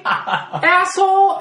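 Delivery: high, loud, drawn-out retort; mock indignant insult. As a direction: stretch out a hammed-up angry comeback. Acted indignant retort to miscalled name.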